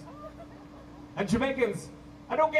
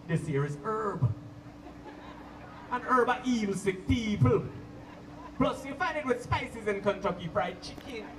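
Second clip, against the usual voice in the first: preaching roots man; 3 semitones lower than usual. Retold or imitated. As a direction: lower Jamaican accent, lay down wisdom. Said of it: "he be like".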